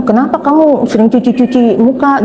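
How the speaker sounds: high-pitched puzzled question; retold puzzlement. Asking a friend about all that washing, puzzled. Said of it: "Saya bingung".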